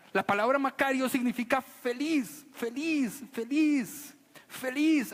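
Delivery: high, loud and beaming, each feliz ringing out; joyful emphasis. Shown as a demonstration. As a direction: proclaim happiness with a bright lift. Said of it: "¿Se han escuchado o han conocido a alguien que se llama Macario?".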